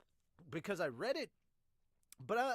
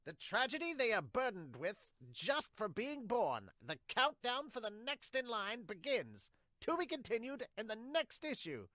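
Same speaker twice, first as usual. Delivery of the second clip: dramatic narration; 7 semitones higher, 3 dB louder than usual. Acted. Reader closing the chapter like a solemn narrator.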